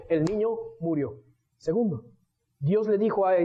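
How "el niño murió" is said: ordinary talk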